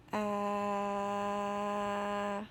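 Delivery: steady, monotone "uhhh" held long; sustained "uh" sound. Shown as a demonstration. Teacher models the sounds on the page, sustained "uh". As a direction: hold the vowel on one even note. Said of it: "tell me the sounds again".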